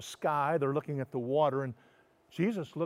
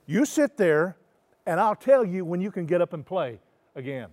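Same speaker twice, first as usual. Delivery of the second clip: loud, firm timeout order; stern parent; 4 semitones higher, 10 dB louder than usual. Acted. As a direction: put a child in timeout firmly. Jesus as parent giving timeout.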